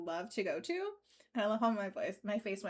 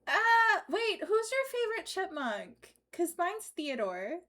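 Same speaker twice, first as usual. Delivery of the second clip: lightly high chipmunk voice; a hint of a squeaky chipmunk; 7 semitones higher, slower than usual. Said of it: "next customer gets a chipmunk voice".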